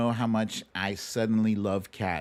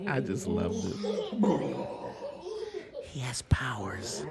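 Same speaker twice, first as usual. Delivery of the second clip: soft whisper to the viewers; whispered aside; 5 dB quieter than usual. Real, not acted. Host whispering to viewers while the clip plays. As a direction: lean in and whisper it.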